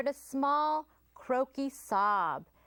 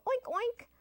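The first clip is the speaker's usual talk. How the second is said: high, light oinking giggle; a little pig giggling "oink, oink"; giggly oink; 12 semitones higher than usual. A narrator's reading. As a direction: giggle through two oinks. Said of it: "oinked a little giggle".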